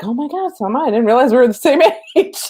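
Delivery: high, loud gasp of disbelief that breaks into a laugh; shocked realisation, giggling at the end; surprised. Retold or imitated. To her friend, learning they're the same age: shocked, laughing.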